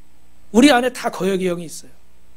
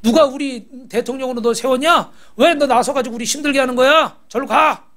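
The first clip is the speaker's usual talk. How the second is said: resentful complaint; 3 semitones higher than usual. Retold or imitated. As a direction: act out an aggrieved crowd railing at its leader. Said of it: "또 막 원망하는 거예요".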